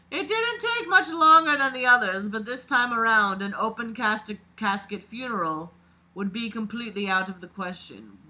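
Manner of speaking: dramatic read-aloud of a creepy story. A narrator's reading. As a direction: narrate it like a confession being read out. Reads the killer's story aloud.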